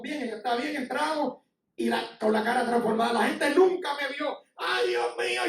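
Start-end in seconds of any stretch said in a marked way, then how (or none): 4.6-5.4 higher-pitched whining lament; mock crying. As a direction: imitate a tearful complainer.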